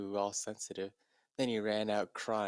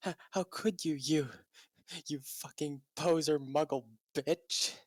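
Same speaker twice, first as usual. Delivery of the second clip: higher, stammering hammed-up accusation; mock outrage; 6 semitones higher than usual. Draco accusing Ebony, mock outrage. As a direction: sputter it out in fake fury.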